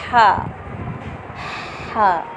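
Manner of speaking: "हा" from the middle of the throat. Shown as a demonstration. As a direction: breathe "हा" out from mid-throat. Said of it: "हलक के दरमियानी हिस्से से निकालना है". ("हा" हलक के दरमियानी हिस्से से)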